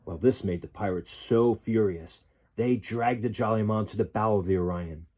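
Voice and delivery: ordinary delivery, nothing marked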